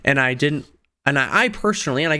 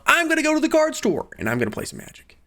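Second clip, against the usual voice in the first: eager self-quote. Retold or imitated. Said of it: "I said to myself".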